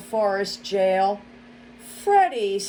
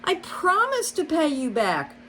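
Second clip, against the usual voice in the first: much higher desperate promise; begging; 10 semitones higher than usual. A narrator's reading. Mole begging the fox for time, much higher. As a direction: plead to be trusted. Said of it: "Barnabas begged".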